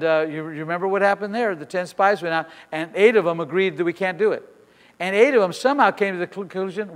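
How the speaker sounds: usual speaking voice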